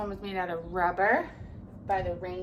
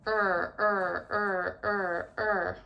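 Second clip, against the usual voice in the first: paddling sound; 4 dB louder, much slower than usual. Acted. Rowing sound in the story.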